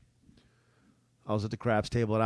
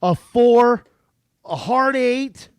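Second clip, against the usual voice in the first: calling the dice; 7 semitones higher, 8 dB louder, much slower than usual. Retold or imitated.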